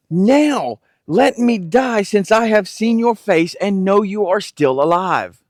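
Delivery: emotional. Acted. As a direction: let the old father's heart break open. Aged father's tearful reunion words.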